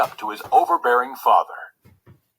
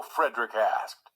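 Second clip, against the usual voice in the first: ordinary delivery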